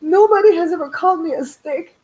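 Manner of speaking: high, loud, gushing mock delight; answering her daughter's joke with mock gratitude; mock flattered. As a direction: ham up being flattered. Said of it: "I'm like".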